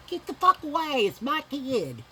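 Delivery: high, loud angry shout; retold furious yell. Mother yelling at nurses to get away from her baby, furious. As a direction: snap at them to back off.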